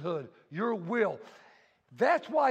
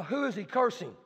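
ordinary talk

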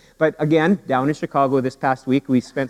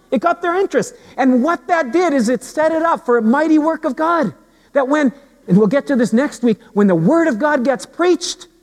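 high, sustained pulpit voice; raised voice driving the sermon home; preaching at full force; 10 semitones higher than usual. Acted. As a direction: build to an urgent, high-pitched proclamation. Preaches to the congregation.

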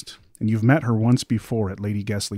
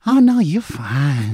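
high, slow, soothing creature voice; soft mimic voice; 4 semitones higher, 4 dB louder, slower than usual. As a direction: reassure him slowly in the mimic's voice. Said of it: "a very soft voice".

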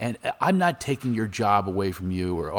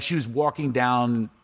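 usual speaking voice

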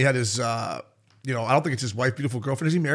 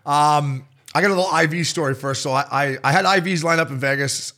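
ordinary delivery

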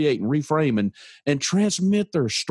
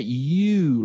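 "you" stretched out, pitch swooping up and down; stress on YOU; 2 semitones higher than usual. Retold or imitated. Man showing how the lady said it, drawn-out stressed YOU. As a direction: draw the word "you" out long. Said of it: "like that".

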